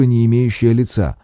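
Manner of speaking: plain reading voice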